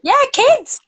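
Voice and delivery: put-on high exclaimed kids; playful high exclamation; mock calling kids. Calling out to viewers.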